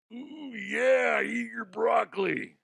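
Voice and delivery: high loud ooh yeah wrestler shout; hammed-up wrestler catchphrase; Macho Man impression. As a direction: bellow it like Randy Savage.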